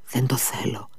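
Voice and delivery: whispered refusal, soft and voiceless. Acted. Sick boy refuses the dog, whispered. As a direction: breathe the refusal out with no voice.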